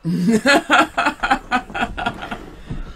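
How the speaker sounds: big burst of laughter; loud laugh. Real, not acted.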